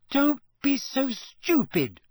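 high, slow, indignant rebuke. A narrator's reading. Hermione quarrels with Ron after shock.

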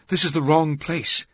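high, loud alarmed warning; urgent. A narrator's reading. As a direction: get the warning across down the phone.

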